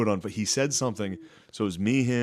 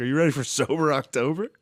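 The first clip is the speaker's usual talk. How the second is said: imitating an eager fan, pitch raised; mock fanboy question, rising at the end; fannish question; 5 semitones higher than usual. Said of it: "fanned out and asked him".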